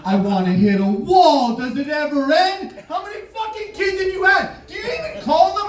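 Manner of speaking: high mock shouting; hammed-up indignant yelling; rising high mock rant; mock outrage. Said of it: "I'm like".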